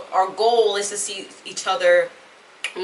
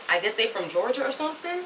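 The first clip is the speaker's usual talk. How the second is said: ordinary talk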